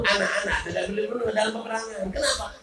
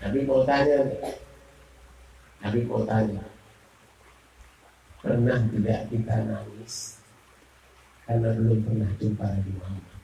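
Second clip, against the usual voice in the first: tender appeal; 7 semitones lower, 4 dB quieter than usual. Real, not acted. Soft, moving closing appeal.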